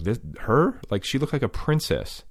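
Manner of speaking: can't believe it was her; incredulous disbelief. Retold or imitated. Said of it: "I'm completely incredulous".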